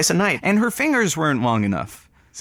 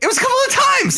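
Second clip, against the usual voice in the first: defensive shout; 3 dB louder than usual. Real, not acted. Defensive outburst in a quarrel.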